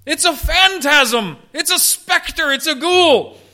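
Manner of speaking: high, slow frightened cry of ghost; preacher acting out panicked disciples; terrified shout. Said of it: "they're terrified and shout out because they say".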